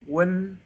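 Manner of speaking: sustained high, even "Wen"; first tone. Shown as a demonstration. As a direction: hold "Wen" at the top of your pitch. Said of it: "The first tone is high pitch".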